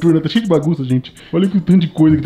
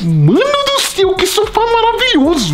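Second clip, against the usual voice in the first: high, slow, delighted; amazed; 13 semitones higher, 3 dB louder, slower than usual. Real, not acted. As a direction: savor the amazement. Delighted by the sofa.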